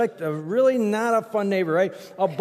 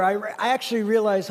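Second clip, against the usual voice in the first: normal talking voice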